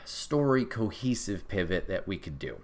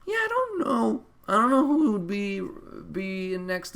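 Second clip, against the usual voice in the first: mock puzzled voice; 11 semitones higher, 3 dB louder than usual. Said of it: "And I'm like".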